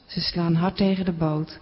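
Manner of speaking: usual speaking voice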